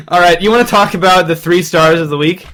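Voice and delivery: ordinary talk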